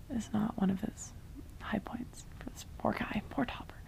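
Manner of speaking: soft unvoiced whisper to the viewers; whispered aside. Real, not acted. Vlogger whispering to viewers about poor Topper, pitying whisper. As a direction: lean in and whisper the pity.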